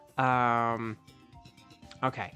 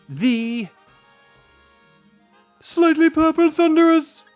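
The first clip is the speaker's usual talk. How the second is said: excited; 11 semitones higher, 10 dB louder than usual. Real, not acted. Hoping for a rare catch.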